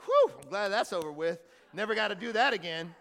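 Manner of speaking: loud, hammed-up sigh of relief; exaggerated, self-satisfied relief; mock relief. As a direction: blurt it out loudly, as if done with a chore. Acting believers relieved confession is over.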